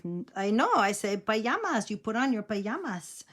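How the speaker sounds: imitated grandmother, "pajamas" as pa-YA-mas; grandma's Mexican accent. Grandma insists to laughing grandkids, Mexican accent. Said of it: "She had a very heavy accent too".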